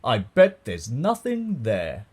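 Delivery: loud, slow, dismissive boast; drawn-out fatherly disdain; scoffing. A narrator's reading. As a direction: scoff it with swagger. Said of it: "and scoffed".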